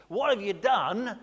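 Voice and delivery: loud, slow furious demand; enraged Moses. Retold or imitated. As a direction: bellow it as Moses in rage. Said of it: "apoplectic with, with rage".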